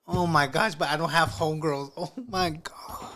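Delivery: high, whiny imitation of an objection; hammed-up high-pitched fretting; mock whining. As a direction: whine it like a helpless complainer. Mimics a whining listener's objection.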